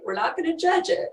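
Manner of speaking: ordinary delivery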